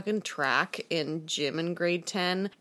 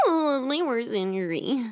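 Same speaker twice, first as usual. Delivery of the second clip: mock whine; 6 semitones higher than usual. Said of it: "and was like".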